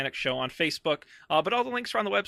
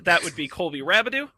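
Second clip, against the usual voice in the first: ordinary talk, nothing marked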